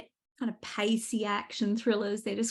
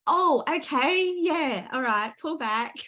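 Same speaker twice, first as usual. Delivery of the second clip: mock surprised realization; 7 semitones higher, 4 dB louder, slower than usual. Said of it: "And I went".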